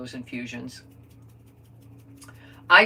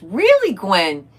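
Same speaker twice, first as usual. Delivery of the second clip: surprised; 9 dB louder, much slower than usual. Real, not acted. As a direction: shoot up in real surprise. Reacts to viewer's comment.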